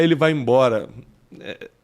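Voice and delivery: ordinary talk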